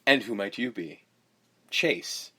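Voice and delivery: cheesy attempt at charm, dropping in pitch; awkwardly smooth. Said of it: "that's [name] trying to be smooth".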